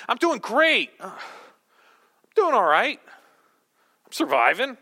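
bright cheer sinking to a glum admission; high and chipper fading to flat; start high, work low. Shown as a demonstration. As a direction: begin cheerful, deflate line by line. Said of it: "I could start high and work low".

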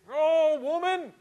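mock-frustrated outburst, high and loud. Grandfather answering grandmother's teasing, hammed-up frustration. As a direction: throw it up high in comic exasperation. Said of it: "he would get all frustrated".

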